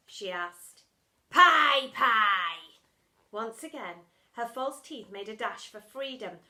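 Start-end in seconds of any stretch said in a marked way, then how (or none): 1.3-2.8 1 loud bark. Acted. Splat loud answer.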